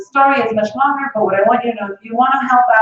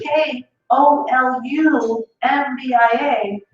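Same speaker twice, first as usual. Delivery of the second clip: very slow, letter by letter; spelling it out; 3 semitones higher, much slower than usual. Shown as a demonstration. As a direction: drag out each letter in exasperation. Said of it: "they changed the whole spelling".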